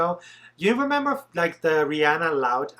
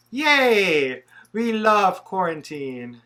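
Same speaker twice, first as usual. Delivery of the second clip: sarcastic; 4 semitones higher, 6 dB louder, much slower than usual. Acted. Sarcastic cheer about quarantine.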